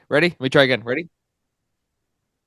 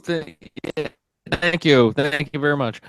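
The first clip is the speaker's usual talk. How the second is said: ordinary talk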